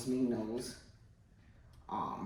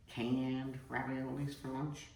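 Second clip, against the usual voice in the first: normal talking voice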